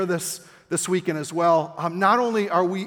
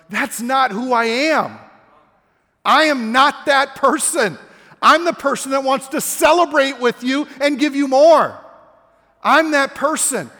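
loud, high, insistent protest; frustrated master; 7 semitones higher, 8 dB louder than usual. Retold or imitated. Master answering the servant who misjudged him, frustrated and loud. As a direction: protest loudly, pitched up and emphatic. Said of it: "the master just got so frustrated 'cause he's like".

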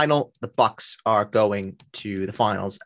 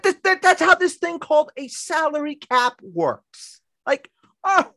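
high mocking rant; 5 dB louder than usual. Ranting at Nets fans, mock voice.

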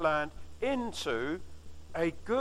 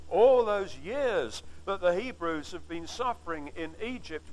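normal talking voice

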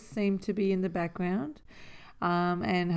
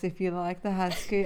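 ordinary talk

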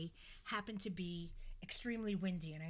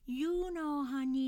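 higher, louder knowing granny voice; old lady voice; 6 semitones higher, 6 dB louder, slower than usual. Retold or imitated. Babushka lady hinting to the confused narrator, high knowing voice. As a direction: tease it like a cryptic old lady.

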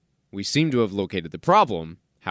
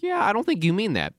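a high, skeptical "yeah right" voice; doubtful partner; 10 semitones higher than usual. Retold or imitated. Partner hearing a flat I love you, skeptical. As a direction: voice the unconvinced partner, pitched up.

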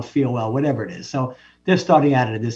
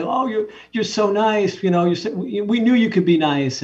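put-on gushing kids; 7 semitones higher than usual. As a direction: coo it like the whole class is fawning. Classmates gushing at bully.

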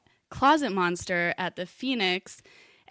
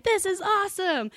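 excited; 12 semitones higher than usual. Retold or imitated. Excited quote of drunk friends.

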